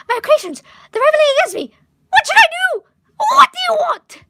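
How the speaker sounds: panicked high shouting in a fake British accent, laughing through it; weird British accent. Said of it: "He's, like, British or whatever".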